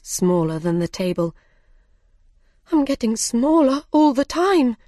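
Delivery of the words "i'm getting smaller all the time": high, raised voice of alarm; alarmed cry. A narrator's reading. Alice crying out that she keeps shrinking, alarmed cry. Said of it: "she cried".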